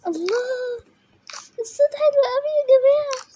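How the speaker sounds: high, loud delighted squeal. Real, not acted. Gamer thrilled by a sound effect, squealing with delight.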